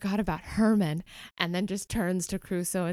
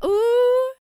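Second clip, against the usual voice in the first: high delighted ooh; 7 dB louder than usual. Acted. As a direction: squeal of delight. Penny delighted.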